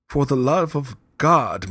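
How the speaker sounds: slow, high, loud pleading cry; desperate plea. A narrator's reading. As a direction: beg for your life. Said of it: "to these words".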